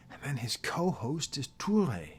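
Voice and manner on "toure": Toure said the French way, tou-RAY, stress on the end; French-accented name. Real, not acted. Host naming his co-host Toure, French accent. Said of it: "I can't help but pronounce it with a French accent".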